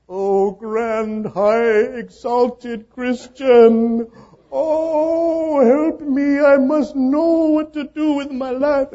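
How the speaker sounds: high, loud, gushing fawning voice; fawning admirer. Retold or imitated. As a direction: gush up high, hammed-up flattery. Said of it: "Somebody comes up to you and they say".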